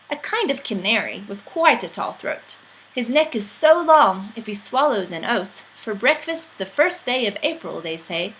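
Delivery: usual speaking voice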